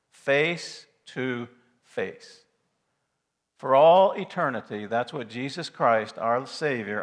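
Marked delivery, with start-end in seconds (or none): 0.1-2.4 each word drawn out very slowly; slowed for emphasis. Shown as a demonstration. Preacher to congregation, very slow emphatic phrase. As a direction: lay it down word by word. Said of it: "Get it now".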